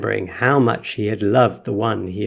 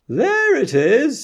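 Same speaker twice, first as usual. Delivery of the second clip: shouting; 4 dB louder, slower than usual. A narrator's reading. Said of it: "someone else shouted".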